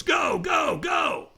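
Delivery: high, strained shout to launch someone; trainer's urgent shout. Retold or imitated. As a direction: imitate a trainer yelling someone off the line. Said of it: "And the trainer goes".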